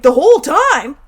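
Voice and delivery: a loud, high, disbelieving "whole time?"; astonished retort as a kid; incredulous. Retold or imitated. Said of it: "I'm like".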